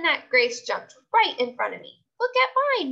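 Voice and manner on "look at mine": high, eager showing-off; excited. A narrator's reading. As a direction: pipe up eagerly for attention. Grace showing off.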